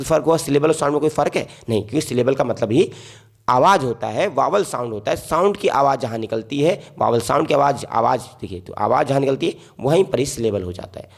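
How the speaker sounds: ordinary delivery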